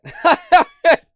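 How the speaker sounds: loud high laughing fit; burst of laughter. Real, not acted. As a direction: crack up laughing.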